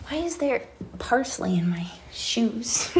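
slow puzzled whisper. Real, not acted. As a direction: breathe it out, baffled. Puzzled aside, voice dropped.

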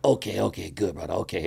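softly imitated Hawaiian local; Hawaiian accent. Local accepting it's only weed, Hawaiian accent. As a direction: back off gently in a Hawaiian accent. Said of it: "that was a decent Hawaiian accent".

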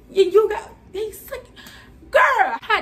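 high, breaking into laughter; laughing outrage. Real, not acted. About the baby on the nipple, high laughing splutter.

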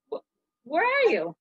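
ordinary delivery, nothing marked

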